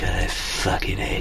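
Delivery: unvoiced, slow whispered grumble; whispered mutter. Cleaners grumbling in the corner, whispered. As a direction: breathe it under your breath, disgusted. Cleaners reacting to sweaty feet.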